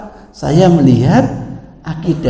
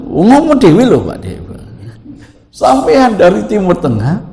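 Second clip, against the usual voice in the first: angry scolding; 7 semitones higher, 6 dB louder than usual. Retold or imitated. Angry Pak Yai scolds him.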